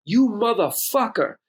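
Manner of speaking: slow, high-pitched angry curse; drawn-out indignant swearing, retold; outraged. Son outraged at dad questioning him, angry.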